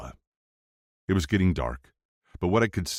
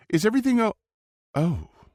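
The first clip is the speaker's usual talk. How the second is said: cut-off surprise; 4 dB louder than usual. A narrator's reading. Sloane walks in, surprised.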